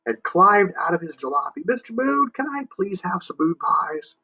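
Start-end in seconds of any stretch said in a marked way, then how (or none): 1.7-4.2 put-on higher, pleading small-boy voice; polite little boy. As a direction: ask sweetly like a little kid. Small boy asks the Moon.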